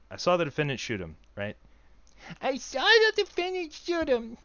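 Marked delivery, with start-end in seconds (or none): 2.4-4.4 put-on much higher, slow baby voice. Shown as a demonstration. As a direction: babble it like a tiny toddler. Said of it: "What would that sound like?".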